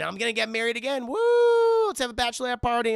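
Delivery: high, loud, hammed-up celebrating; party whoop. Mom announcing another marriage, whooping excitedly. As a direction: build to a big mock woo.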